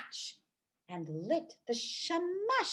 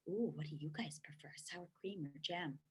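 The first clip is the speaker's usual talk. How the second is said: whisper; 7 semitones lower, 14 dB quieter than usual. Real, not acted. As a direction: lean in and whisper. Aside to the listening children.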